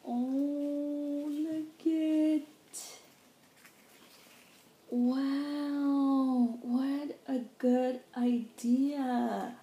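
high, drawn-out wonder; real gushing admiration; amazed delight. Real, not acted. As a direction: marvel aloud, stretching the words. Marvels at the gift.

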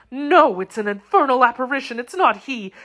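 high-pitched, frightened denial; increasing terror. A narrator's reading. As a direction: refuse in dread to believe what you see. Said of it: "with increasing terror".

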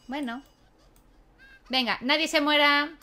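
ordinary talk

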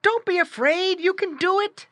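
put-on high, urging boy's voice; crying out encouragement. A narrator's reading. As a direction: cheer on a nervous pet in a high voice. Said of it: "cried the boy".

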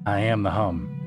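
plain reading voice

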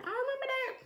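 very high put-on squeak; squeaky mock grudge. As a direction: squeal it as a playful vow. Repeats vow far higher.

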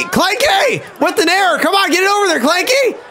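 high, agitated shouts to a fielder; alarmed live game reaction; urgent calling out. Real, not acted. Urging fielder after an error.

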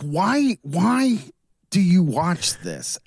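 imitating a worn-down, baffled parent; exasperated dad. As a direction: plead in mock exasperation. Exasperated dad quoting himself.

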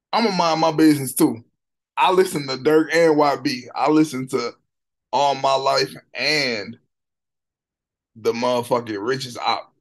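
loud, high hype; excited. Real, not acted. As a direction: hype it up loudly. Excited reaction to the song.

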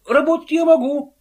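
loud, high protest; exclaiming. A narrator's reading. Invisible man protesting to the chairman, loud and high. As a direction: cry it out in protest. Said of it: "воскликнул невидимый".

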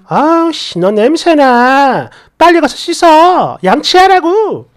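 bully the kid to go wash, hammed up; imagined aunt threatening the niece, mock angry. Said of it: "라고 협박한 줄 알겠네".

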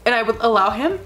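ordinary talk, nothing marked

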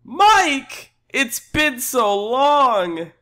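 high, gushing, drawn-out cry; overdramatic reunion. Acted. Customer greets long-lost Mike, high and overdramatic. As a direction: stretch it out with melodrama. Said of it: "Make it more dramatic".

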